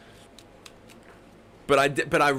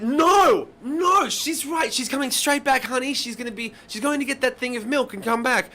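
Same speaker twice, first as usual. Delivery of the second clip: much higher, louder frantic reassurance; panicked checkout lady; 10 semitones higher, 3 dB louder than usual. Retold or imitated. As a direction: rush in high and alarmed to comfort the child. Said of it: "the woman at the checkout went".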